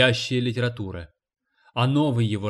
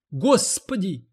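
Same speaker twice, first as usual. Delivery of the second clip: a high wailing "Господи!"; wailing cry; 7 semitones higher, slower than usual. A narrator's reading. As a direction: cry it out at the top of her pitch. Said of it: "завопила уборщица".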